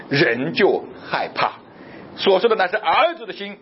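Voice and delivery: ordinary talk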